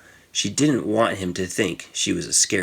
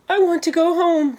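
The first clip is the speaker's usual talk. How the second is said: put-on high, louder pleading little girl; frightened cry; 4 dB louder than usual. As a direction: wail to go home like a terrified child. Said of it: "cried Stephanie".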